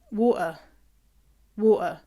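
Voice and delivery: "water" as WAW-uh, glottal stop for the T; normal dropped-T water. Shown as a demonstration. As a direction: drop the T out of water. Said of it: "without the T".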